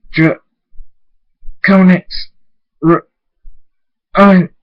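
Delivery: loud, high broken syllables; struggling to speak. A narrator's reading. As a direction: force the words out with long gaps. Struggling character forces out broken words.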